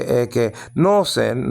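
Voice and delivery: ekenosen drawn out, stressed on NO; slow eke-NO-sen, leaning on the third syllable; wrong third-syllable stress. Shown as a demonstration. Teacher showing what not to say, ekenosen slowly stressed on NO. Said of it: "no, because the accent is on the second".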